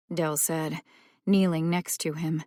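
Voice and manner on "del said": ordinary delivery, nothing marked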